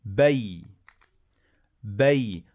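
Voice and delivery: بي said with a clean stop on the silent ي; teacher drills the sukun; sukun demo. Shown as a demonstration. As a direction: close بي in one syllable. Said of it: "لازم نعمل وقفة على الحرف الساكن".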